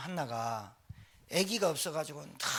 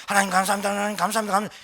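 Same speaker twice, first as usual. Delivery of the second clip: gabbled thanks, louder and running together; rushed prayer; 7 dB louder, much faster than usual. Retold or imitated. 방언 습관 때문에 기도를 빠르게 몰아치는 목소리. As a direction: rattle off the prayer too fast. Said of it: "이러니까 말이 빨라져 버려요".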